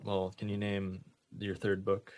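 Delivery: normal talking voice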